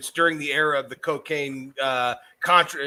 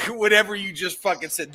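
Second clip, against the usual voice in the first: ordinary talk, nothing marked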